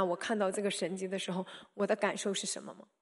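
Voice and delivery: ordinary talk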